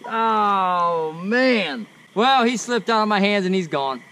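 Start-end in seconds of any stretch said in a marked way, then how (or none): none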